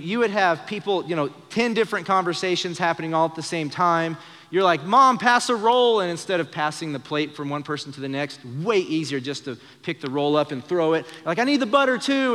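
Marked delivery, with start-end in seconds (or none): 4.8-6.0 high, loud call across a rowdy table, slowed; shouted dinner request. Retold or imitated. Son shouting to mom across the crowded dinner table, loud call. 11.4-12.3 high, loud call over the din; shouted request. Retold or imitated. Kid yelling for butter over noisy family dinner, loud shout.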